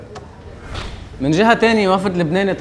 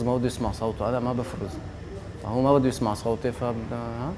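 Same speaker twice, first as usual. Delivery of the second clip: much softer, as if told to shut up; hushed voice; 4 semitones lower, 9 dB quieter than usual. Acted. Host mocking the minister's order to keep quiet, in a hushed voice. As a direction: drop the voice so the minister won't hear it. Obeying minister, stops his voice.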